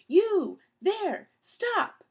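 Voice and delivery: very high, very loud shouts; yelling after someone. A narrator's reading. Mister Poe yelling at running Count Olaf, loud high shouts. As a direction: bark each word across the distance.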